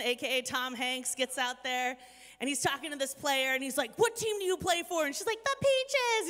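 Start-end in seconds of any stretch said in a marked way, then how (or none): 4.0-5.1 high, loud imitation of a yelling coach; mock yelling. Coach demanding an answer from his player, mock yelling. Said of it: "he basically is yelling at her". 5.4-6.3 high, loud squeak of an upset ballplayer; mock upset player. Player answering the yelling coach, mock upset. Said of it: "and she starts crying".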